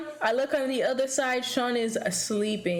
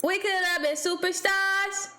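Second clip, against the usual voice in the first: mocking dancer; 7 semitones higher than usual. Mock dancer line.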